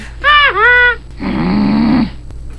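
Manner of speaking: loud, high, exaggerated guffaw; hammed-up laugh at someone's expense; mocking fake laugh. Caller's mocking laugh, acted out.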